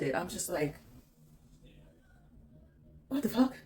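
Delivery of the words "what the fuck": high, loud startled cry; shocked outburst. Retold or imitated. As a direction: blurt it out in disbelief. Shocked at being pushed.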